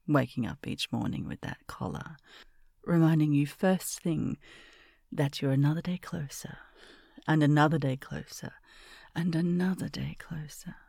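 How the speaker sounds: hushed, close-mic ASMR whisper; intimate whisper roleplay. Acted. Miss murmuring to her pet, close whisper. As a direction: breathe every line close to the ear.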